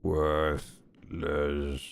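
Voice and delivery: very low, drawn-out questioning voice; low creature voice. Acted. Other crab answering the polite stranger, low creature voice. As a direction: drop right down into a slow creature voice.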